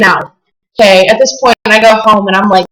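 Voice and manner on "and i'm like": ordinary delivery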